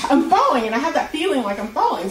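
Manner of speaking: normal talking voice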